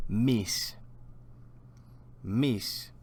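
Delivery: Mis said alone in Spanish with a clear s. Shown as a demonstration. Teacher says Mis for students to repeat. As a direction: model Mis crisply. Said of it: "repeat after me".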